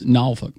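Norfolk as NAW-fuk, slow first syllable; native pronunciation. Shown as a demonstration. Practising the listener's local Norfolk, said NAW-fuk. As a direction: drawl Norfolk without R, short ending. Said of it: "you say it by itself".

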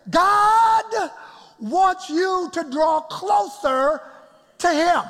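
high, loud and slow preacher climax; preaching shout. Real, not acted. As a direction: lift it up to the rafters.

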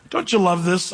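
normal talking voice

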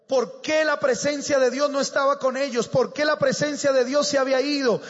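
high, driving rhetorical questions; full-force preaching. Real, not acted. Preacher demanding where the shout of jubilation went, impassioned preaching. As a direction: fire question after question at the crowd.

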